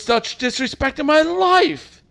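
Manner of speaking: hammed-up indignation. Offended customer to the clerk, loud slow indignation. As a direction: play the insulted lady to the hilt.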